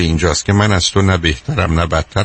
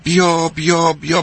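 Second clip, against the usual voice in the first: retold calling out; 7 semitones higher, slower than usual. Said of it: "داد زد".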